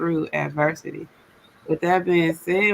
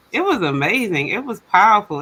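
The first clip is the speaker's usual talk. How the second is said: ordinary delivery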